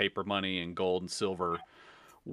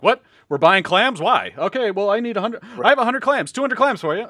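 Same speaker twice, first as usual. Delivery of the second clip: high, loud mock haggling; acted frantic buyers; 12 semitones higher, 10 dB louder than usual. People suddenly buying clams as currency, hammed-up frantic haggling. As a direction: play a worked-up crowd, pitched way up.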